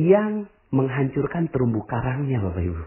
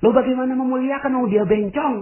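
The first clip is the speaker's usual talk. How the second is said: incredulous objection; 12 semitones higher, 3 dB louder than usual. Pretend. Imagined objecting listener.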